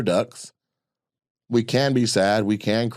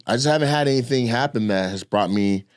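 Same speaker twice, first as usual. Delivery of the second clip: ordinary talk, nothing marked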